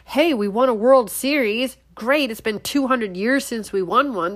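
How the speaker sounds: usual speaking voice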